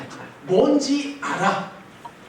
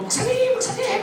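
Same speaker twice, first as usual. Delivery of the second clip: much higher, sing-song mock greeting; fake cheerful greeting; 12 semitones higher, 3 dB quieter than usual. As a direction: play a kid pretending to be delighted. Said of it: "막 반가운 척하면서".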